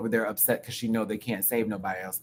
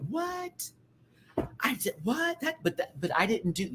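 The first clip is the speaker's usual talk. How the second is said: mock shocked denial; 11 semitones higher, 6 dB quieter than usual.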